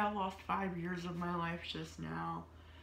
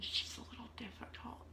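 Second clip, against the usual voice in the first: hushed whisper, no voice at all; 4 semitones higher, 7 dB quieter than usual. Retold or imitated. Quoting the doctors' notes about her, in a whisper. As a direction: breathe it like a secret.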